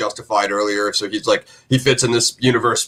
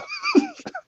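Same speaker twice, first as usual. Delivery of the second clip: short laugh; 6 dB quieter than usual. Real, not acted. Laughs after own joke.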